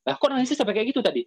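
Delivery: high, quick, incredulous question; mildly acted surprise; puzzled questioning.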